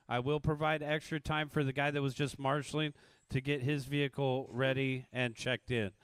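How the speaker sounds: ordinary talk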